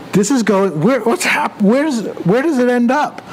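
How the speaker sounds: playful astonished questioning; baffled wonder. Retold or imitated. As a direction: marvel at where the food goes. Staring at plate, amazed.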